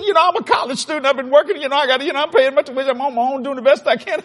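high-pitched mock crying plea; imitating tearful waitress. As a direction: act out a student tearfully explaining herself. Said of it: "she starts crying".

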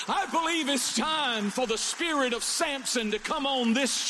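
sermon building to a high-pitched climax; rally the congregation like a revival preacher; impassioned preaching. Real, not acted. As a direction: preach with mounting fervor, stretching the final calls.

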